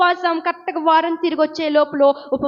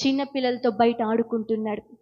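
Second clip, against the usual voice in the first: ordinary talk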